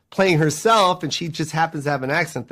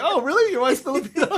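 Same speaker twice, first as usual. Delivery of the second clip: high-pitched, laughing mock surprise; 11 semitones higher, faster than usual. Comedian imitating friends hearing about his wife, mock surprise. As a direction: play amazed, pitch jumping up through a laugh. Acting out others' astonished reaction.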